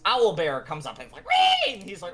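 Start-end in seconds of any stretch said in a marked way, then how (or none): 1.3-1.7 very high, loud creature shriek; shrieking mock beast cry; owlbear screech. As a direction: imitate a monster's piercing squeal.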